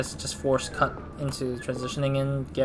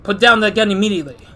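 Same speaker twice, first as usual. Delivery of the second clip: loud, higher command; yelling; 5 semitones higher, 7 dB louder, faster than usual. Acted. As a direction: bark the order at the gunman. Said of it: "Yelling at him".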